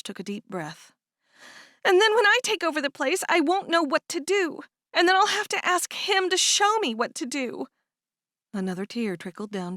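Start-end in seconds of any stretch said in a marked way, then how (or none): 1.8-7.7 higher, louder, on the edge of tears; a young woman fretting aloud; upset younger sister. Acted. Cherish upset about the farm.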